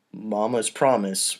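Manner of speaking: ordinary delivery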